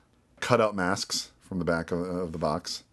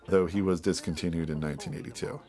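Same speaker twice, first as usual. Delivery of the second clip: ordinary talk, nothing marked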